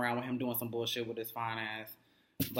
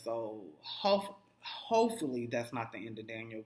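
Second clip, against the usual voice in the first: normal talking voice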